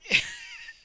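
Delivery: high helpless laugh; burst of laughter. Real, not acted. Reader laughing at the snot story, high burst. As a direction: crack up out loud.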